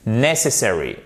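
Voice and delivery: "necessary" as NESS-essary, falling; stress on first syllable. Shown as a demonstration. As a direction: hit NESS, let the rest fall. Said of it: "la première ici pour ce mot qui sera accentué". (« necessary » accentué sur la première syllabe, NESS)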